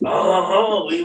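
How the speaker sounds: loud, hammed-up French interjection; mock French voice. Husband interrupts wife's request with teasing, mock French accent. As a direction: burst in as a cartoon Frenchman. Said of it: "like the French voice".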